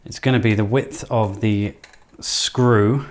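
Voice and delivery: ordinary talk, nothing marked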